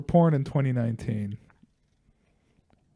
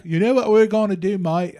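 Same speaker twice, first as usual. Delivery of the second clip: bad South African accent; 5 semitones higher, 4 dB louder than usual. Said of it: "I can't do this accent".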